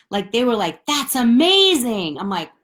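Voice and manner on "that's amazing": mock gushing praise. Classmates' praise mimicked.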